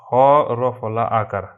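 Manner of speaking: usual speaking voice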